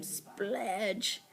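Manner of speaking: soft, slurred splurge; low-volume oozing effect; squelch noise. A narrator's reading. Slime pours on top of the boy, squelching.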